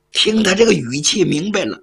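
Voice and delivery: plain reading voice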